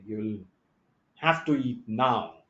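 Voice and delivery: a hint of a strict mom ordering dinner; mom's command. Teacher imitating a mother telling her child to eat, slow and firm. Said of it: "like how our mom used to say".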